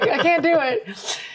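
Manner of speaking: high, slow refusal through a laugh; giggling no to the photographers. Retold or imitated. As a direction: laugh as you turn them down.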